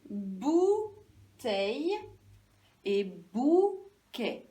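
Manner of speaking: "Bouteille et bouquet" very slow, each bou held; first syllable stretched. Shown as a demonstration. Teacher comparing how two words begin, first syllable stretched. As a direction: hold bou, pause, finish each word. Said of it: "J'entends la même chose au début".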